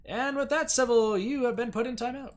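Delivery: announcing a player's timeout; mock announcement. Real, not acted. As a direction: proclaim it like a game show.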